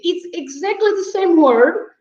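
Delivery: normal talking voice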